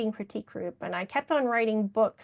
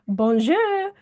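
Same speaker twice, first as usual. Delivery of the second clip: a bright, lightly French "Bonjour", a bit higher and louder; playful French greeting; 5 semitones higher, 4 dB louder than usual. Retold or imitated. Answering her husband's phone call, a playful mock French hello. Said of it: "I would answer the phone and I would go".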